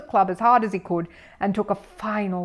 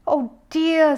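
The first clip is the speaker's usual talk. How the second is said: dismayed; 9 semitones higher, 4 dB louder than usual. A narrator's reading. Missus Brown dismayed.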